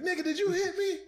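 high shocked woman's voice; mock outraged woman. Woman in the dark accusing her date, high and shocked. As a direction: squeal it in outraged disbelief. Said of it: "she was like".

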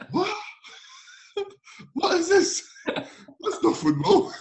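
laughing, high incredulous disbelief; half-laughing at how absurd it is; mock disbelief. Young player baffled by long-ball football, laughing disbelief. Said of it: "I'm like".

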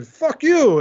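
a sudden high, loud retort; mock yell. A guy in a retold argument fires back with a mock yell.

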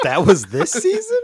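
high, slow, loud squeal through laughter; laughing imitation of the disbelief voice. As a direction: copy the squeal while laughing. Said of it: "do that voice".

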